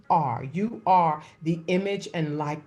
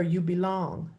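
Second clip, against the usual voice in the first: usual speaking voice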